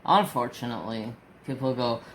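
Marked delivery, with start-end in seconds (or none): none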